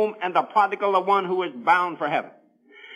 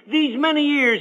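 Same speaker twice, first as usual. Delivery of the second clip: higher, acted whine of grievance; complaining; 8 semitones higher than usual. Older son to his dad about years of service, complaining. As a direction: put a son's complaint in it, pitched up. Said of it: "he complained to his dad".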